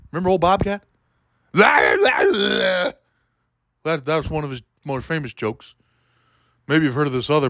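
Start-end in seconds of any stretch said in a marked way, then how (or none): 0.1-3.0 high strained screechy comic voice, cracking into a shout; Bobcat Goldthwait impression. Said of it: "He doesn't talk like that anymore".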